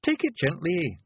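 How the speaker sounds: high, rough little Scottish pictie, gently; a tiny Scots warrior breaking bad news; Feegle voice. Billy's Feegle voice.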